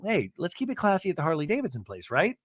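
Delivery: ordinary delivery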